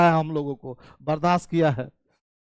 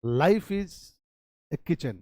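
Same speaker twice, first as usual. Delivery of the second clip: slow motto; much slower than usual. Shown as a demonstration. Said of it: "हर आदमी का सोच देखो".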